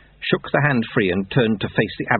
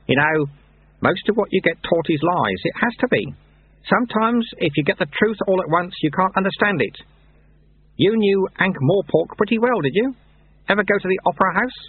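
higher-pitched mentor voice; knowing Lutze; 11 semitones higher than usual. Acted. Lutze teaching Lobsang about lies, knowing. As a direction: lead the pupil along with questions.

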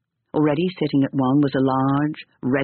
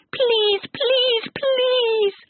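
put-on very high pleading boy; begging child; much slower than usual. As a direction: whine please like a small boy. Boy begs.

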